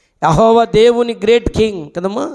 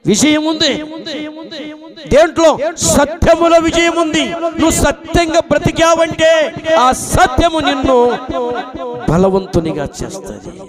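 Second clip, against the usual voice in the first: raised, ringing sermon proclaiming victory; fiery preaching; 8 semitones higher than usual. Acted. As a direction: preach it out high to the congregation. Preaching at full force.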